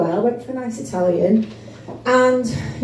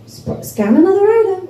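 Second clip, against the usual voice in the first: put-on American accent; 9 semitones higher, 4 dB louder than usual. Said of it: "speaking to me in an American accent".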